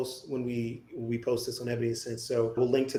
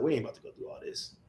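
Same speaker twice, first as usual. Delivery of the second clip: muttered aside; 6 dB quieter than usual. Retold or imitated. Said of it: "they were like".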